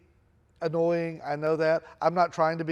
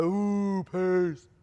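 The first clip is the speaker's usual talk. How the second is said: teasing classmates; 2 semitones higher than usual. Retold or imitated. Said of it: "Everybody's like".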